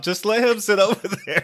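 a loud, laughing crowd voice; laughing mock crowd. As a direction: brush him off loudly, laughing.

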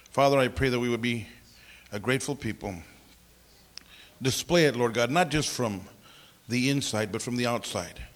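low, soft prayer voice; hushed, low-pitched address to God. Real, not acted. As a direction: pray it quietly, subdued and low. Leads a prayer.

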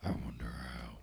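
very low, whispered Batman rasp; mock hoarse superhero voice. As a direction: growl it like a voice-wrecked Batman.

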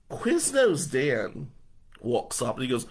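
ordinary delivery

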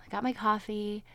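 usual speaking voice

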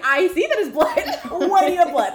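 high, loud, laughing protest; mock exasperation. Sam questioning Dean about the blood, exasperated. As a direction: push back in comic disbelief.

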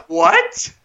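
high, incredulous What?; stunned disbelief. Retold or imitated. Recalling the theater moment for a co-host, mock stunned What. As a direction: squeak out a baffled What. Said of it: "I was sitting in the theater like".

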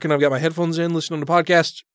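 usual speaking voice

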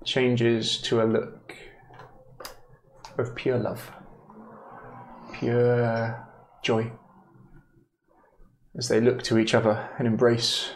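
hushed scene-setting with long pauses; measured, tender narration; game master narration. A narrator's reading. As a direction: let each phrase hang, storyteller style.

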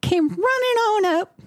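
put-on storytelling in a broad Southern accent; Southern belle. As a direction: play a wounded Southern belle. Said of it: "Southern Dan Bell's just fine".

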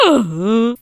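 cracking squeak on a sung note; imitated voice crack. Singer mimics her worst voice crack at church, cracking. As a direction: let the voice break mid-sound. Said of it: "It was literally just like".

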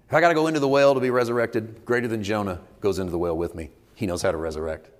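normal talking voice

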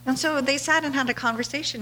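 ordinary delivery

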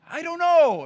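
hammed-up testy answer; mock snappy reply. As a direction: fire back defensively. Bickering texts with wife.

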